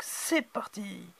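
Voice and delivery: slow, soft teasing "c'est parti"; hushed suspense. Pretend. Unboxing host teasing viewers before opening, hushed and slow. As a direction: draw it out quietly to build suspense.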